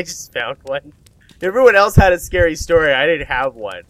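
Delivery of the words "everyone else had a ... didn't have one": high, loud protest; protesting, voice jumping up then settling; loud self-defense. Acted. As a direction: blurt out a loud excuse. Defends himself when challenged about his phone.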